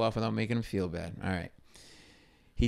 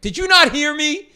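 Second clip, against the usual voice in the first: high, loud furious question; exasperated shout, pitched way up; snapped; 10 dB louder than usual. Retold or imitated. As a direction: lose your temper at an intern. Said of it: "I snapped and I went".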